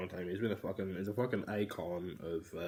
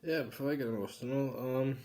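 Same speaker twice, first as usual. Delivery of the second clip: suddenly loud and higher; loud switch; 4 semitones higher, 9 dB louder than usual. Real, not acted. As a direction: break the mood with a burst. Snaps to loud.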